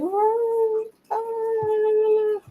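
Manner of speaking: very high, long drawn-out wail; hammed-up bawling in a high voice; mock wailing cry. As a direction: fake crying, held high. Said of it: "like, wailing cry".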